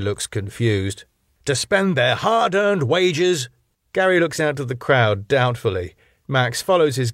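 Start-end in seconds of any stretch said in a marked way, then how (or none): 1.5-3.5 high, slow, laboured emphasis; long-suffering. A narrator's reading. Boss explains the bar's customers to staff, long-suffering. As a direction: spell it out with weary patience. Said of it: "Max, long-sufferingly".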